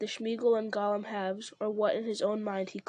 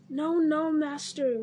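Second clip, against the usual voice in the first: high, loud creature cry; roaring; 5 semitones higher, 5 dB louder, slower than usual. Acted. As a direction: cry out in desperate protest. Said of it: "roared Gollum".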